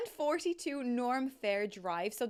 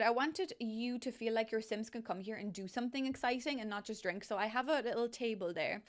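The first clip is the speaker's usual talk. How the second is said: ordinary delivery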